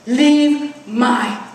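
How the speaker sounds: put-on loud, very slow toddler protest; protective two-year-old son. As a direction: imitate a little boy defending his mother, word by word. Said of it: "And he says".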